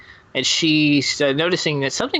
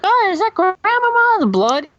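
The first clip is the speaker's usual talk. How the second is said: put-on high girly voice; 5 dB louder than usual. Red voiced in retold story.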